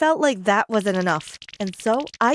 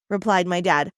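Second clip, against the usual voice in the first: usual speaking voice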